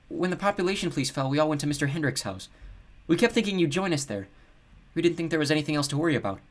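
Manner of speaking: high-pitched, apologetic explanation. A narrator's reading. Nina explaining to Luke why they were absent, apologetic. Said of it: "Nina said apologetically".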